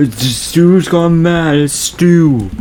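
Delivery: high, drawn-out mock panic; hammed-up movie victim; playing Randy. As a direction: wail the accusation slowly and high. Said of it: "You're Randy".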